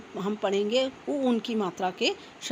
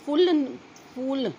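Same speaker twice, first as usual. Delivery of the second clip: फूल called out higher and louder, long u held; emphatic long u; 4 semitones higher, 5 dB louder, slower than usual. Shown as a demonstration. Teacher stressing फूल loudly so children hear the long u. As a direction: boom out फूल with the long u stretched. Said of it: "फूल होता है ना बच्चों".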